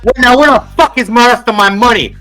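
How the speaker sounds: put-on bratty kid demanding. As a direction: demand the money like a little tyrant. Brat demands money.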